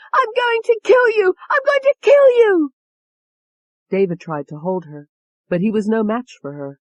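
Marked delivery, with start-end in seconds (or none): 0.1-1.3 very high, loud shriek; murderous scream. A narrator's reading. Tony lunging at David with the letter opener, screaming. 1.5-2.7 even higher, loudest shriek; louder murderous scream. A narrator's reading. Tony lunging at David, screaming at full force.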